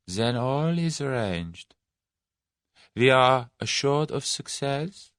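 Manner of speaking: drawn out and deliberate; slow, probing question. A narrator's reading. Mysterious leader pressing the German for assurance, slowly. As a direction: test him with each word.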